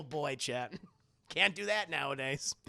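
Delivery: usual speaking voice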